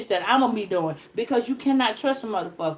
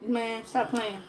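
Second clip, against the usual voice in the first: soft dismissal; 3 semitones lower, 9 dB quieter, slower than usual. Real, not acted. Quiet aside.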